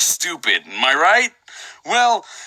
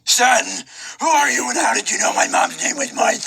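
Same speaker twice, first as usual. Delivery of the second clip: mock shout; 6 semitones higher than usual. Mock shouting son reply.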